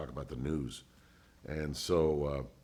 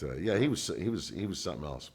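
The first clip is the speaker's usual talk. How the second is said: usual speaking voice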